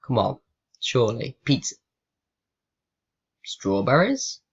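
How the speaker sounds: ordinary delivery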